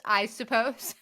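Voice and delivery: ordinary talk, nothing marked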